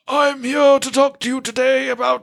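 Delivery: exaggerated Eddie Redmayne-style villain voice; mock villain impression. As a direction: ham it up as a ridiculous arms dealer. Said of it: "he sounds like, um, Eddie Redmayne".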